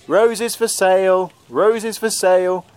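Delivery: put-on high, slow old man's street call; old peddler calling. A narrator's reading. Disguised young man calls through the streets, slow old peddler's cry. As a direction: call out your wares like an old flower seller. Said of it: "in an old man's voice".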